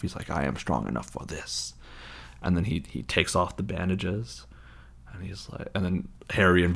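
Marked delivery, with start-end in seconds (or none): 0.3-1.7 low, soft villain voice; imitation of a weak dark lord; sinister master. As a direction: answer darkly, low and quiet. Voldemort answers his servant.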